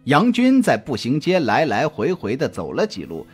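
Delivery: usual speaking voice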